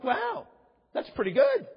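high, drawn-out wow; playful over-the-top wow; mock amazement. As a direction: fake being impressed. Mock impressed reply.

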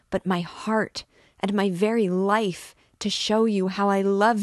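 desperate lover pleading with rising passion; a tormented priest baring his heart. Acted.

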